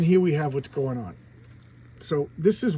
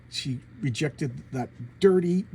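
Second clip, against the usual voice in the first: ordinary talk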